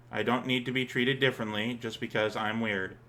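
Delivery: ordinary talk, nothing marked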